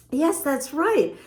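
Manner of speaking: plain reading voice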